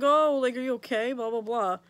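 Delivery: lightly hammed-up dad checking in; imitating dad. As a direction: pipe up loudly as a chatty dad. Acting out dad talking to her.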